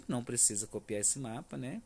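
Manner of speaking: usual speaking voice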